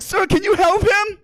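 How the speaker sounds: high, drawn-out plea; panicked cashier. Retold or imitated. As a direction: wail it out in mock panic. Cashier begging for help at seizure.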